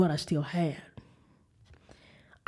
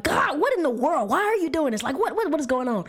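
high-pitched, frustrated pleading with God; exasperated outburst; 7 semitones higher than usual. Retold or imitated. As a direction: hurl agitated questions at God, pitch jumping up. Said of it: "it wasn't even calm like that. It was more like".

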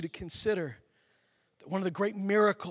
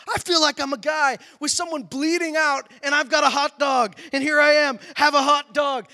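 higher, louder sermon push; fired-up preaching; 7 semitones higher, 8 dB louder than usual. Acted. Pastor urging the congregation, fired-up preaching. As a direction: drive the point home to the crowd.

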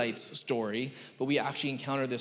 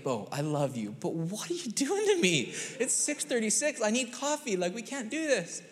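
high, pleading half-laughing dad voice; begging his son before coffee; pleading tired dad; 8 semitones higher than usual. Retold or imitated. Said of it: "I'm like".

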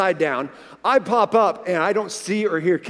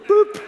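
a high, loud boop; cartoon boop; 13 semitones higher, 4 dB louder than usual. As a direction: pop it out like a cork. Imitating wife bobbing up.

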